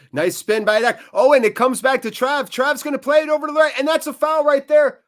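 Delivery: high and loud, racing through the attack; quick excited commentary on a breaking move; excited play-by-play. Real, not acted. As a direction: call the counter-attack fast and keen. Attack builds, fan commentates.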